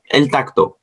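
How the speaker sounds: usual speaking voice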